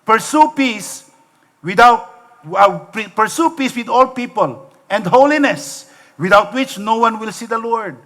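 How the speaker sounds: slow, high, solemn verse reading; reading scripture aloud. Shown as a demonstration. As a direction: lift each word of the verse slowly. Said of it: "sabi nga po sa Hebrews chapter twelve".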